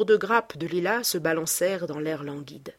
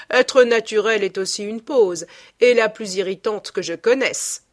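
louder, amused retort; exclaiming while laughing; 3 semitones higher, 7 dB louder than usual. A narrator's reading. Lord Henry retorts to Basil, louder and amused. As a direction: fire it back with a laugh in the voice. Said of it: "s'exclama en riant".

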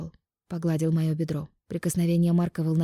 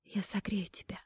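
soft whisper in his ear; breathy seductive whisper; 13 dB quieter than usual. Acted. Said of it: "прошептала ему на ухо".

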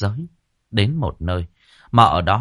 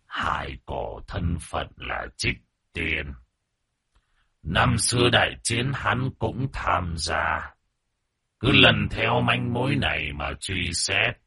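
put-on old man voice; 5 dB quieter, slower than usual. A narrator's reading. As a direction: speak slowly like a frail old man. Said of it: "giọng nói lại là một ông lão già nua".

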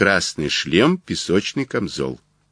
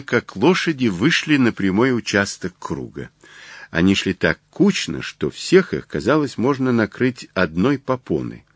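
usual speaking voice

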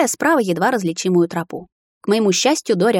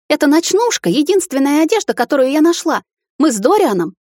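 much higher and louder, rushing to explain; heated defence; 8 semitones higher, 5 dB louder than usual. A narrator's reading. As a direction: blurt out the excuse, voice up high. Wife defends herself heatedly.

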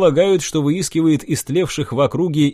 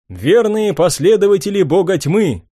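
rousing address; 5 semitones higher, 4 dB louder than usual. Acted. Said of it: "обратился я к собравшимся".

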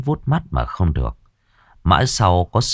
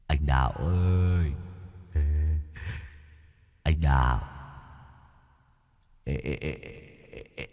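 ghostly whisper; 2 semitones lower, 8 dB quieter than usual. A narrator's reading. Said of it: "thì thào".

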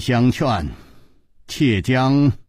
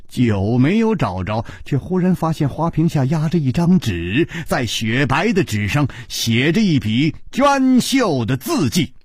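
usual speaking voice

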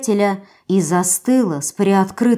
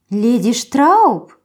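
surprised; 3 semitones higher, much slower than usual. Acted. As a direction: echo the name in disbelief. Surprised repeat of the name.

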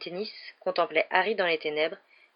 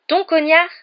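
very loud, high outburst; indignant shout, anger returning; angry exclamation; 11 semitones higher, 12 dB louder than usual. A narrator's reading. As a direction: explode in disbelief. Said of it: "s'exclama Harry".

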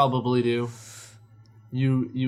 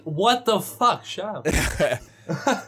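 slow, higher mock outrage; annoyed outburst; 6 semitones higher, 4 dB louder, much slower than usual. Friend imitating his reaction to annoying game players, slow and exasperated. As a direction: snap it out like you're fed up with them.

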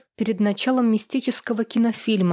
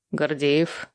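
low, stern man's voice; lower-pitched, threatening pickup; menacing; 5 semitones lower than usual. A narrator's reading. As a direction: answer the phone with a low, forbidding edge. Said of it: "грозно ответил знакомый тембр".

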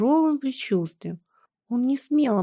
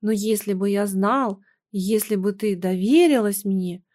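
ordinary delivery, nothing marked

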